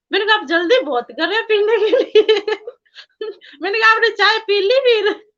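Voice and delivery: high, loud, giggly retelling; laughing while talking. Real, not acted. Devotee teasing guru about hurried tea, laughing. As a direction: let real laughter break through the words.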